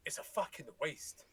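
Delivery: ordinary delivery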